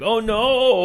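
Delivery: high, squeaky oh no; hammed-up sudden dread; mock dismay. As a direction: realize the mistake in a high panicked squeak. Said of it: "he's like".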